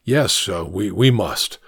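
ordinary delivery

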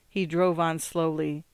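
plain reading voice

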